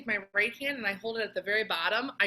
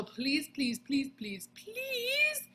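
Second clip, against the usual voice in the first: please rising higher each time, last please up high; playful begging; 6 semitones higher, much slower than usual. Acted. Teacher begging students to stop blowing too hard. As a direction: plead sweetly, climbing to a squeak. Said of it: "begging students not to blow hard".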